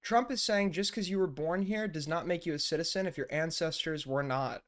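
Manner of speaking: normal talking voice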